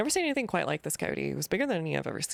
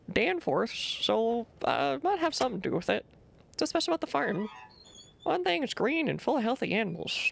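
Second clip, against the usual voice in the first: put-on grumpy old farmer; 7 semitones higher than usual. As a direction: warn strangers off your land. Voices the farmer NPC.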